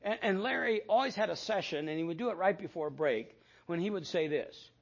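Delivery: ordinary talk, nothing marked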